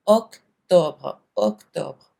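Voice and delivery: octobre with a rounded "ok-tob", no English "ber" ending; French octobre. Shown as a demonstration. Teacher contrasts octobre with English अक्टूबर. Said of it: "French में".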